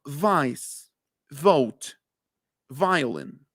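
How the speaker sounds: upper teeth on lip for the v in vice; English v. Shown as a demonstration. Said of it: "Así es como se pronuncia".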